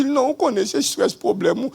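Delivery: high, loud insistence; retold protest. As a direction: protest hard that it's only stress. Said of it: "Je dis".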